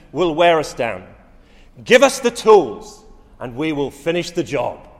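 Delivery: loud, stirring radio speech, big climbs on key lines; rally a nation at war like a wartime leader; Churchill's wartime oratory. Retold or imitated. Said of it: "Now that's preaching".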